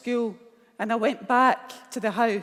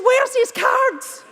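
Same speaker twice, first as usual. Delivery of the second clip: very high, loud, stretched slowly out; urgent excited demand; 10 dB louder, much slower than usual. Retold or imitated. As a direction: grab it now. Mum urgently demanding the card.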